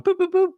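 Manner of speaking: high imitated keypad beeps; phone beeps. Telling the co-host how the dentist dialed, imitating phone beeps. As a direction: chirp the dial tones up high.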